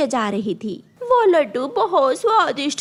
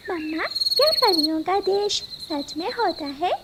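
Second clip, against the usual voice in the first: put-on little girl; 4 semitones higher than usual. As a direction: ask like a curious small girl at bedtime. Little girl asking mother at bedtime. (छोटी बच्ची की मासूम आवाज़)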